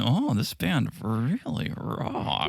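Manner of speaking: put-on subdued, very slow parody accent; Sandy impression. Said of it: "a little subdued. There's a, somewhat of this accent".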